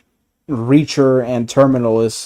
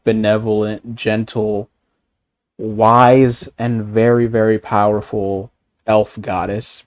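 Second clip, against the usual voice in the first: normal talking voice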